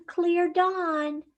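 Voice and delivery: usual speaking voice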